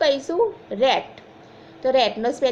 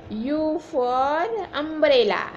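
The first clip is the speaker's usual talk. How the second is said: slow model phrase; 3 semitones higher, much slower than usual. Shown as a demonstration. Teacher models phrase for class.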